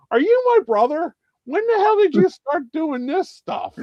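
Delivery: high-pitched incredulous questioning; comic high-pitched disbelief; retold incredulity. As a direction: act out grilling your brother, voice way up. Acted confronting of his brother.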